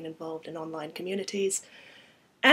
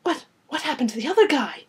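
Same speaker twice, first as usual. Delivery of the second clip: high, loud, stammered question; shocked, panicky voice; rising panic; 7 semitones higher, 10 dB louder, faster than usual. Acted. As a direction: stumble into the question in alarm. Heroine panicking after the killing.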